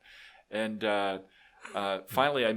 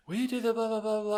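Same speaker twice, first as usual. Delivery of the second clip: higher mock nagging voice; imitating the wife; 12 semitones higher than usual. Mocking the wife yelling at her husband, higher voice. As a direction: play the nagging wife, high-pitched. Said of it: "it's like".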